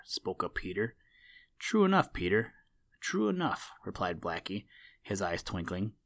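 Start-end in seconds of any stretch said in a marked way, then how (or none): 1.6-3.7 sly. Acted. Blacky caught out, twinkling.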